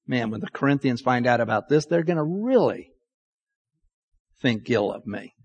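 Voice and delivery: worried inner thought. Retold or imitated. Paul fretting to himself about the Corinthians, soft and low. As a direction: mutter it under your breath like a private worry. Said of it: "And then Paul's thinking".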